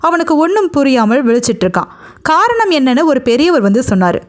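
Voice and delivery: ordinary delivery, nothing marked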